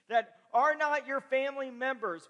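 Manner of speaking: fervent sermon voice, driving each phrase home; impassioned preaching. Acted. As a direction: preach it with growing conviction, pitch climbing. Preaches at full force.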